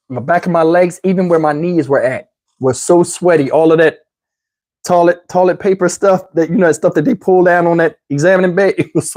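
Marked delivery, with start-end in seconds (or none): none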